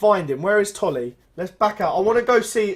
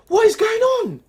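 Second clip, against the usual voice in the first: incredulous; 6 dB louder than usual. Real, not acted. Disbelief at terrible football.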